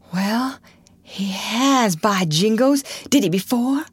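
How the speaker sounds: put-on high, relieved boyish exclamation; joyful surprise. A narrator's reading. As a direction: marvel with relief at the news. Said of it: "looked with joy in his heart".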